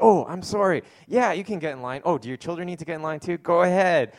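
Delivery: hammed-up Northwest niceness, over the top; overly polite. Pastor voicing Northwest bank customers waving a line-cutter in, in gushing, over-polite voice. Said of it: "they just kinda go overboard".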